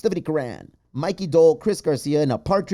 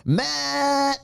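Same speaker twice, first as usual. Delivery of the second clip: high call across the room; 12 semitones higher than usual. Real, not acted. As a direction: call his name out. Calling out a name.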